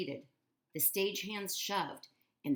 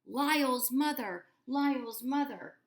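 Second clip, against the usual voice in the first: higher, slower sing-song call across the water; 8 semitones higher, 7 dB louder than usual. A narrator's reading. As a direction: stretch it out like a call over the river. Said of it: "Hector's sing-song voice rang out".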